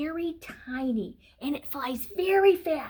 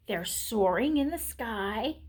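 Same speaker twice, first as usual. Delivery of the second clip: ordinary delivery, nothing marked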